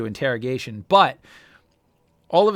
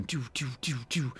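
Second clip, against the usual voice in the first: mock gunfire; 10 dB quieter than usual. Imitating turret gunfire.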